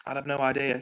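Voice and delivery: normal talking voice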